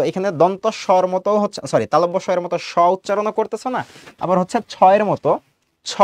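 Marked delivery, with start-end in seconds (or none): 5.8-5.9 ছ alone as an aspirated "chho"; chh sound demo. Shown as a demonstration. Teacher contrasting it with s, ছ pronounced as aspirated chh. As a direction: say ছ by itself with a puff of air. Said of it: "এরকমও বলছো না".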